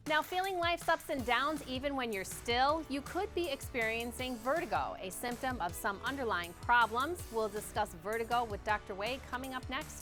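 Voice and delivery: upbeat broadcast promo read; TV host read. Acted. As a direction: read it like a station tease. Host's TV read.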